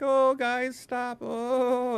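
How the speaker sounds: high, pleading dog voice; voicing the dog. Young dog begging the arguing couple to stop, high voice. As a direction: plead like a small dog breaking up a fight. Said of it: "be like".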